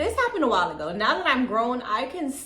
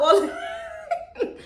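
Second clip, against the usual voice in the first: high burst of laughter; 14 semitones higher than usual. Real, not acted. Laughs at remembered threat.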